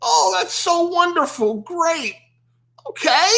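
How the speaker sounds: lightly mocking canned reply; robotic response. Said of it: "you want the robotic response".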